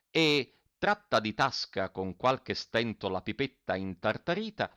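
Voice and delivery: ordinary delivery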